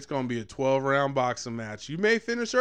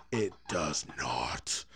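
slow, lower whispered repeat; slow hushed whisper; 9 semitones lower, 4 dB quieter, much slower than usual. Real, not acted. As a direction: breathe it out slowly in a whisper. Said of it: "His dick doesn't quit".

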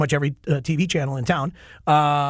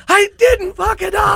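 much higher, loud, stretched-out cheer; relieved triumphant shout; 5 dB louder than usual. Retold or imitated. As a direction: celebrate like you just dodged disaster. Relieved backstage celebration.

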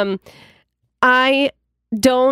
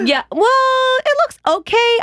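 much higher, tentative polite voice; hesitant fake politeness; 14 semitones higher, slower than usual. Reassuring a friend about her hair, in a high hesitant voice. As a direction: strain to sound nice about it. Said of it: "Sometimes I'm like".

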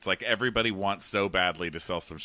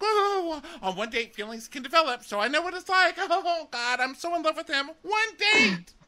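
put-on much higher and louder, swooning girl; shrill mock gushing; 5 dB louder than usual. Mocking the smitten daughter talking to her mom, high and loud. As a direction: overplay a lovesick girl ending in a shout. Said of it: "And she's like".

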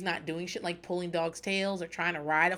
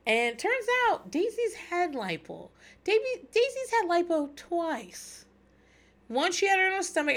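shocked gossip; 11 semitones higher than usual. Real, not acted. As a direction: drag out the juicy news, scandalised. Gossip reveal.

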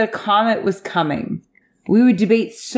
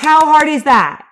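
high, loud, fed-up repeat; raised-voice incredulity; exasperated demand; 5 semitones higher, 5 dB louder than usual. Real, not acted. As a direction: hammer the question home in frustration.

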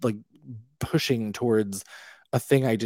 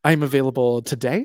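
mock eager; 4 semitones higher, 6 dB louder than usual. Eager reply, retold.

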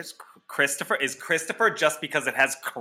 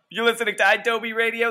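radio announcer; 11 semitones higher, faster than usual. Acted. Radio break station ID.

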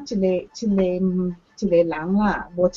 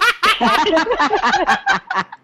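loud, high laughing burst; hearty laugh; 9 semitones higher, 5 dB louder than usual. Real, not acted. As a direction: crack up at the joke, loudly. Laughs at guard dog joke.

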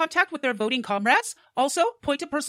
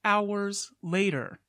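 deadpan narrator; 6 semitones lower, much slower than usual. Acted. Narrator time-skip caption.

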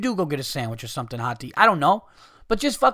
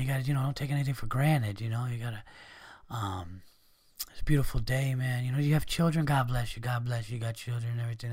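soft, much quieter, pleading homeless guy; polite desperate beggar; 5 semitones lower, 7 dB quieter than usual. Retold or imitated. Polite beggar sweet-talking a passerby, softly pleading. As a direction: sweet-talk a stranger softly for a handout. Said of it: "the polite, desperate ones".

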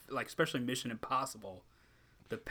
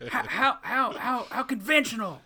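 wrestling commentator; 12 semitones higher, 8 dB louder than usual. Pretend.

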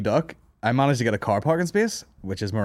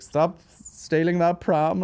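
joking mock scold; 7 semitones higher, much slower than usual. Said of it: "[name]'s joking around".

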